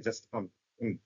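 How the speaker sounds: "আম" then "উম", squeezed to a short hum; reduced am. Shown as a demonstration. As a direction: say am as a quick weak "উম". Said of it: "ওরা যখন দ্রুত উচ্চারণ করে".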